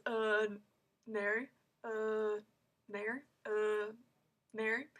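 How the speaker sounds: drawn-out "Uh", a pause, then "Mary"; halting imitation. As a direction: stretch each "Uh" before saying "Mary". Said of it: "how he said it is exactly how I say it".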